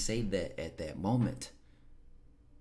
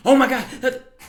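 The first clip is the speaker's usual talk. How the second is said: much higher and louder, hyped-up squeal; overexcited hype; 11 dB louder than usual. Shown as a demonstration. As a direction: demo of burning out with hype. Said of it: "if I was overexcited and if I was hyping".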